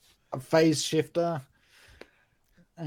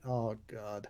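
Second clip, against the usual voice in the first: whispered groan; despairing whisper; 5 semitones lower, 12 dB quieter than usual. Real, not acted. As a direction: breathe it out in dismay. Bad roll reaction.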